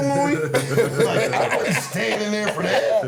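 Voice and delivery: high laughing retelling. Real, not acted. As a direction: laugh through the words. Laughing retelling childhood fear.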